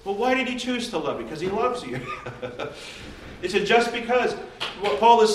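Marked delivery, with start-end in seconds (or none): 2.0-3.4 soft laugh. Real, not acted. Laugh after punchline.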